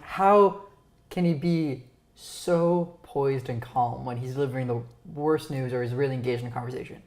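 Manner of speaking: ordinary talk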